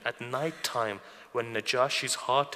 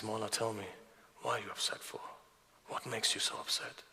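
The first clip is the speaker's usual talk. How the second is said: softly voiced, gentle question; hushed concern; 7 dB quieter than usual. Follower to the upset Imam, hushed concern.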